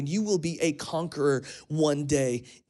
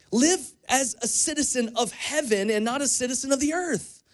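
preaching; 7 semitones higher than usual. Acted. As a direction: drive it home to the congregation. Preaching the application.

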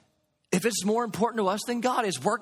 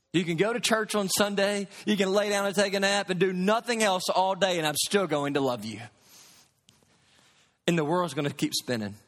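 ordinary talk, nothing marked

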